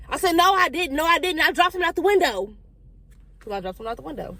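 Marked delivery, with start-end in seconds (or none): 0.4-2.5 high, louder protest of innocence; insistent denial. Retold or imitated. Child denying the accusation to mama, high insistent protest. 3.4-4.4 quiet admission. Retold or imitated. Child privately admitting what really happened, quiet aside. Quiet confession of the same words.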